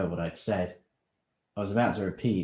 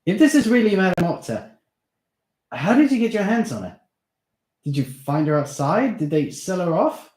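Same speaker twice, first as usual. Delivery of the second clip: urgent; 12 semitones higher, 10 dB louder than usual. Acted. Steve demands answers.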